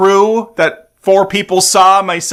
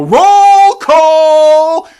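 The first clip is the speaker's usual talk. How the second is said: belted sing-song call, much higher and louder; hype announcer call; 10 semitones higher, 6 dB louder than usual. Pretend. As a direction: shout it like a ring announcer. Announcing the cast list.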